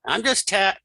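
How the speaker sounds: ordinary talk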